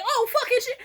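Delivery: very high mock panic; retold high-pitched shocked cry; crowd in shock. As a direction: squeal it like alarmed onlookers. Everyone's shocked reaction, retold.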